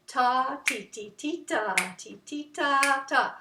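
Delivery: chanted long ta's and short ti's; measured, beat-by-beat chant; rhythm syllables. Shown as a demonstration. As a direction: tap the rhythm out with the voice. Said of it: "See how that goes together?".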